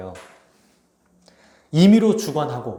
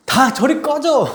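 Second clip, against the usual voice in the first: loud, high, drawn-out mock outburst; hammed-up angry dismissal, slow and high; exasperated shout; 9 semitones higher, 5 dB louder, slower than usual. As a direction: act out yelling at everyone to clear off. Imagined exasperated Jesus dismissing disciples.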